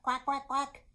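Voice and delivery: high, loud, even quacking; duck quacks. Pretend. Storyteller making duck sounds for babies, playful quacks. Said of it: "make the sound of a duck".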